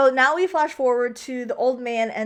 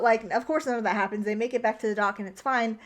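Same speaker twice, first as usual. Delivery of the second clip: ordinary delivery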